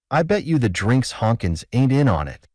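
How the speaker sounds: ordinary delivery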